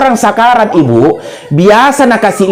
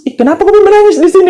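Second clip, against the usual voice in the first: startled question; 11 semitones higher, faster than usual. Retold or imitated. Ustaz scolds crying student.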